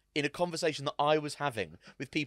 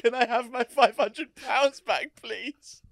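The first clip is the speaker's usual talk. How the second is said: high, slow, pleading retelling; sheepish mock request; 10 semitones higher, 7 dB louder, slower than usual. Re-enacting asking for his money back, sheepish. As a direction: ask cap in hand, hammed up.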